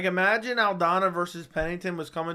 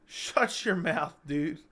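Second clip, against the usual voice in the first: slow, stretched-out shut your mouth; drawn-out shut up; much slower than usual. Acted. Joking at a co-host, slow and stretched. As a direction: drag every word of it out. Mock reply to co-host's hype.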